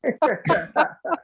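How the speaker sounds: short high burst of real laughter. Real, not acted. Laughs at the joke.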